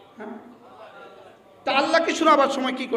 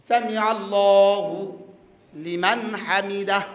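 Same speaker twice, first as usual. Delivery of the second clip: very slow, lower recitation of Sami আল্লাহু; 5 semitones lower, much slower than usual. Shown as a demonstration. Preacher showing the congregation the phrase slowly, slow recitation. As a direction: draw out Sami আল্লাহু লিমান Hamida word by word. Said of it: "যিনি তার প্রশংসা করছেন".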